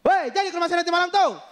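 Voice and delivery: high, sustained calling-out voice; mock long-distance yell. Bima villager calling to a distant house, mock yelling. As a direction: holler to a far-off neighbour, hammed up. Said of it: "kalau ngobrol ya emang harus teriak-teriak".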